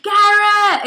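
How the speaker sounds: high, loud drawn-out call. Retold or imitated. Retelling a shout to Garrett outside a tour bus, calling out. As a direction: holler the name high and long.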